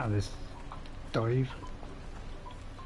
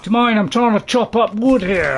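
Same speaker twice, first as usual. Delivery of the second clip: loud, higher indignant protest; exasperated outburst at an interruption; annoyed shout; 7 semitones higher, 8 dB louder than usual. Real, not acted. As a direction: snap at the intruder to back off. Annoyed at enemy while chopping.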